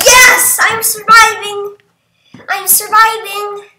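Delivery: high, loud celebration; elated high-pitched gamer shout; triumphant cheer. Real, not acted. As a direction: cheer that you survived, easing slightly. Celebrates surviving the vote.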